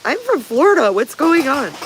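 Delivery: high, loud puzzled pet voice; dog baffled by falling leaves, high and loud; voicing the dog. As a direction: play the confused Florida dog. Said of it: "Look at her. She's like".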